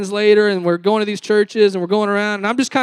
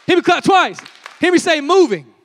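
higher and louder rallying call; calling out to the crowd; 9 semitones higher, 7 dB louder than usual. Real, not acted. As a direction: rouse the congregation, clap once, clap twice. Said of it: "call and response to wake crowd".

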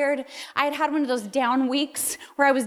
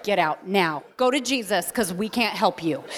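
a hint of a lower husband voice, lightly voiced; husband's stern dismissal; 7 semitones lower than usual. Husband sending his wife off to Jesus, lightly voiced stern order.

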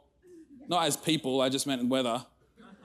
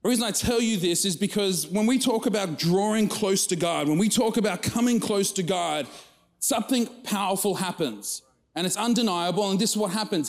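higher, fuller preaching voice; 7 semitones higher than usual. Acted. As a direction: build into the message with conviction. Sermon preaching rises.

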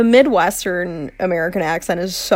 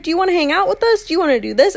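high, fast, friendly cheerleader voice; bubbly cheerleader; 8 semitones higher, much faster than usual. Retold or imitated. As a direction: chirp it like a sweet cheerleader. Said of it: "They're just like".

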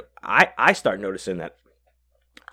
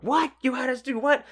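upset disbelief. Retold or imitated. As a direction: imitate a friend aghast at what he's been told. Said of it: "might have been a little more upset".

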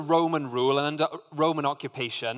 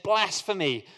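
horrified outburst; 6 semitones higher than usual. A narrator's reading. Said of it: "tore his clothing to show his horror".

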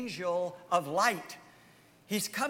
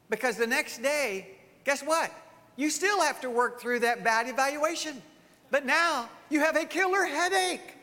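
usual speaking voice

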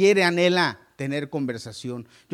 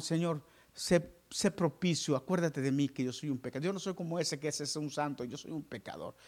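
humble sinner's plea; 6 dB quieter than usual. Acted. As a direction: beg quietly, head bowed. Preacher voices humble sinner praying.

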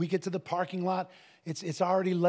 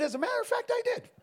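high proud answer; faster than usual. Retold or imitated. Proud answer to pastor's wife.

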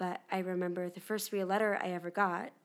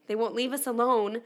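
ordinary delivery

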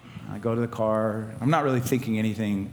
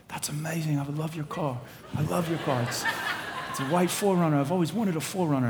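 imitated British accent, pitched higher; Jonathan; 6 semitones higher than usual. Friend thrilled to be given a car, imitated British accent. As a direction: put on a delighted Brit, higher voice. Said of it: "he's British".

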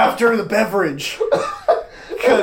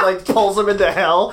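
normal talking voice

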